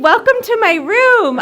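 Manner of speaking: high, drawn-out chirpy welcome; hammed-up perky hostess; orientation leader. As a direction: greet newcomers like a peppy campus guide. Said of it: "I was an orientation leader".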